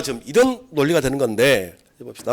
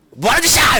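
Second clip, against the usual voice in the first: mock angry shout; 9 semitones higher than usual. Shown as a demonstration. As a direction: yell at the dress company. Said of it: "이렇게". (업체에 화내며 높게 외치기)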